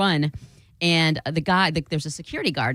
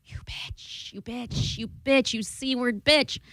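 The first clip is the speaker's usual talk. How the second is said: cursing under breath; 5 semitones higher, 3 dB quieter, much slower than usual. Retold or imitated. Cursing at the lock.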